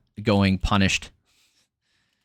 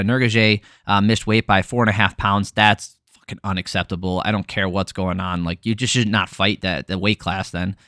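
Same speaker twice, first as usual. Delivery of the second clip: ordinary delivery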